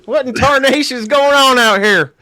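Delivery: put-on high, loud hillbilly old-timer shout; bellowing cranky backwoods geezer; old prospector. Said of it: "it's Louisiana, not Arkansas".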